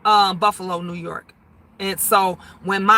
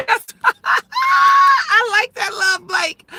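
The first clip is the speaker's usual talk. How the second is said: high, loud cackling through the words; real laughing; 13 semitones higher, 3 dB louder, much slower than usual. Real, not acted. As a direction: crack up at a friend's joke. Cracks up at viewer's joke.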